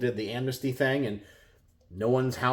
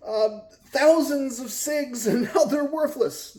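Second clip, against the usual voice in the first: higher, hammed-up wail of ruin; mock despair; 10 semitones higher than usual. Mocking a sig owner who thinks he's ruined, hammed-up wail. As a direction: whine it like a melodramatic ruined investor. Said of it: "are you feeling like".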